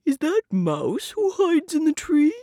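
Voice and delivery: lightly voiced bear calling a question. A narrator's reading. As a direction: call up to the tree as Bear. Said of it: "Bear calls".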